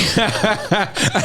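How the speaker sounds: high laughing bird chirp; sparrow whistle. Acted. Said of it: "I've been working on a new sparrow".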